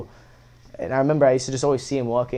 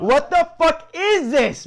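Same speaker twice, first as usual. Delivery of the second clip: high, slow, incredulous cry; looking at a plastic Snapple bottle, high slow disbelief; real disbelief; 4 dB louder, slower than usual. Real, not acted. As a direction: sound truly baffled by the bottle.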